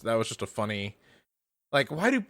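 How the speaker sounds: ordinary talk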